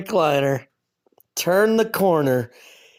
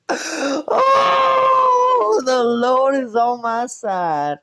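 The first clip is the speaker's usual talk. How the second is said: excited exclamation; 10 semitones higher, 4 dB louder, much slower than usual. Real, not acted. Exclaiming in relief.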